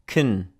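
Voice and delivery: "kun" with a short schwa; the weak form of can, said as "kun"; reduced can. Shown as a demonstration. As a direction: isolate the unstressed "kun" sound. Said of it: "this sounds like".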